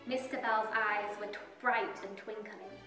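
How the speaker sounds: usual speaking voice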